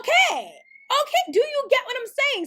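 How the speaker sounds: loud, high, fed-up okay; exasperated okay. Real, not acted. Vlogger fed up with the threats she faces, shouting high and loud. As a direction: throw up the hands, really exasperated.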